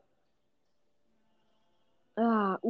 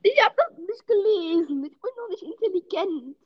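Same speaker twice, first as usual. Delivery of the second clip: high, tearful whimper of excuse; whiny near-crying; 9 semitones higher than usual. Real, not acted. Gamer defending himself to his mocking friend, tearful whine. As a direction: whine like you're about to cry. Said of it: "Ich heul gleich".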